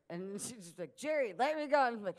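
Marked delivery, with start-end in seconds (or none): 1.0-1.9 hammed-up indignant mom; mom screaming. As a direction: imitate mom yelling to be released. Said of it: "if she's screaming at me".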